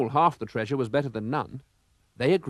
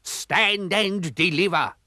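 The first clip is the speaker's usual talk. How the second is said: higher, slow commanding yell; pirate challenge; 7 semitones higher, slower than usual. A narrator's reading. Pirate captain challenges the rivals, slow yell. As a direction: demand the treasure.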